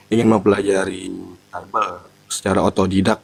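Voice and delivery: ordinary talk, nothing marked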